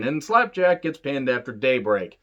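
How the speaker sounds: ordinary delivery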